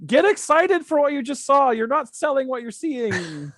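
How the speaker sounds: very high, strained mock yell, easing off at the end; retold yelling. Fan to the commentator on TV, retold yelling. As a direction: imitate yelling advice at someone on TV. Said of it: "I'm yelling to the commentator going".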